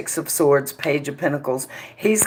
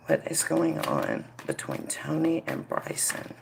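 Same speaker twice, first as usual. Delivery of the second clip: hushed invocation; 7 dB quieter than usual. Real, not acted. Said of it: "hushed invocation to the spirit".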